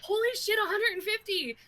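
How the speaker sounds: high excited disbelief; excited quote. Retold or imitated. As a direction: squeal at the number. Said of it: "they're like".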